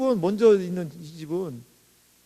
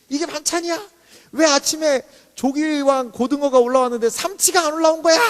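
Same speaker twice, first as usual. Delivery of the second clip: high-pitched mock tantrum, building louder; prickly teen tantrum; 6 semitones higher, 5 dB louder than usual. High school senior griping to mom over the breakfast fish, prickly and indignant. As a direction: play the fussy daughter protesting. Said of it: "이 고삼 딸은 엄청 까칠해요".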